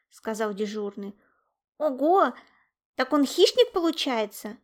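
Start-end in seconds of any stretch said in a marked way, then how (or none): 1.8-4.6 high, loud amazement; wowed, rising question; surprised. A narrator's reading. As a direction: marvel at the news. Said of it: "удивились друзья".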